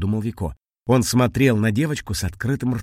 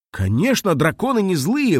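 higher, emphatic blurt; eager, raised-pitch outburst; 8 semitones higher, 3 dB louder than usual. A narrator's reading. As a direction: blurt it out indignantly. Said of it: "выпалил Вико и нахмурился".

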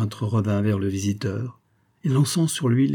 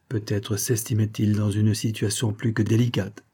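usual speaking voice

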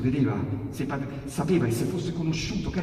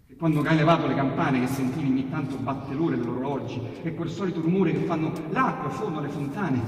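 usual speaking voice

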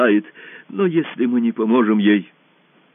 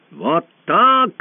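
loud, high cry of fury; enraged shout; 9 semitones higher, 6 dB louder than usual. A narrator's reading. Usurper over the fallen empress, enraged shout. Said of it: "закричал в бешенстве узурпатор".

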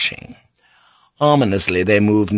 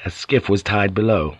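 plain reading voice

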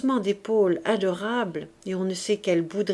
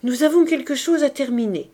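higher, louder entreaty; pleading; 5 semitones higher, 6 dB louder than usual. A narrator's reading. Marius begging his wife to leave them, pleading. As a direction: plead to be left to finish. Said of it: "répondit Marius d'un ton suppliant".

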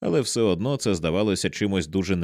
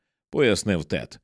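ordinary delivery

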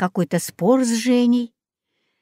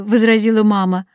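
ordinary delivery

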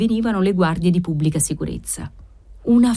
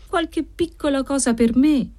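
higher, sighing admission with sly malice; sighed; 8 semitones higher than usual. Acted. Debtor sighing a sly confession to the usurer. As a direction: let it out on a sigh, a little sly. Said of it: "sospirò don Pasqualino".

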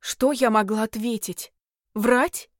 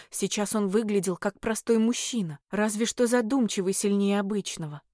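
plain reading voice

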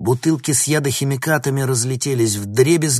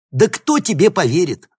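loud, high-pitched mocking challenge; scornful sneer; 9 semitones higher, 9 dB louder than usual. A narrator's reading. Professor scoffs at student's threat, loud and sneering. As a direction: throw the question down with scorn. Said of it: "усмехнулся профессор Клебин".

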